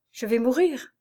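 ordinary delivery, nothing marked

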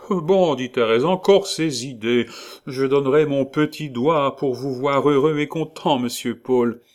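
high, loud motherly landlady voice; kindly landlady. Acted. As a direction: chirp it like a bustling landlady.

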